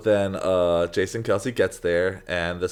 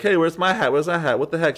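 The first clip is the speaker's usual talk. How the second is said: high, indignant complaint; mock angry fan; 8 semitones higher, 4 dB louder than usual. Fan demanding his hat from the staff lady, indignant. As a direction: whine about the missing hat. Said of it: "some guy's like".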